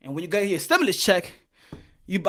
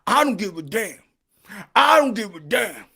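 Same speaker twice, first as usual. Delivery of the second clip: ordinary delivery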